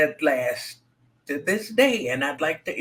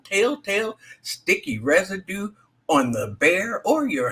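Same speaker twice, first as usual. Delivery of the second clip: ad read; 6 semitones higher than usual. Acted. Reads a mock sponsor ad.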